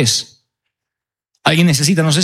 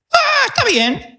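"Ah, está bien" in a much higher, slow, meek voice; meek husband; slower than usual. Acted. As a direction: play the husband giving in with a high, slow voice. Esposo acepta el plan.

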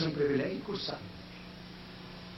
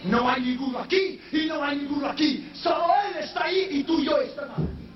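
preaching at full force; 13 semitones higher than usual. Acted. Preacher closing to the congregation, sung shout. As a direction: proclaim it with everything.

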